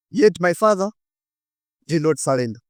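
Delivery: usual speaking voice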